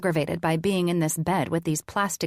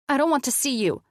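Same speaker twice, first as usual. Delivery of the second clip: barked; 8 semitones higher, faster than usual. A narrator's reading. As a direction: bark it at her. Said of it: "I barked".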